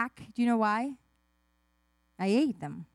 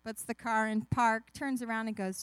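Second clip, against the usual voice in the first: ordinary delivery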